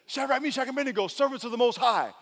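high, ringing summons; calling out. Acted. Nebuchadnezzar calls to the men in the fire, calling out. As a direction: call out to men across the fire.

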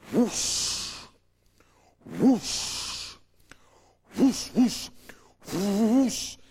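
loud, airy whooshes with pauses between; imitated whoosh. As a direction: make angels sweep in with your mouth. Imitating fiery angels appearing.

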